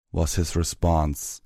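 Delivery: ordinary delivery, nothing marked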